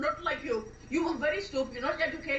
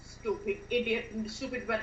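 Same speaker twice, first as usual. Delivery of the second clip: bitter muttering; 7 semitones lower, 4 dB quieter than usual. Acted. Left alone, grumbles after he leaves.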